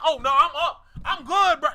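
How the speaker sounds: loud, giddy outburst; hammed-up excited yell; mock hype shout. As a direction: act thrilled over a DM. Acted hype after nude DM.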